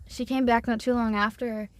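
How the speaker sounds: ordinary talk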